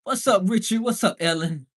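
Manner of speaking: ordinary talk, nothing marked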